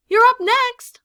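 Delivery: high, loud call across the playground. Acted. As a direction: call his name from far off. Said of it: "calls from the tetherball court".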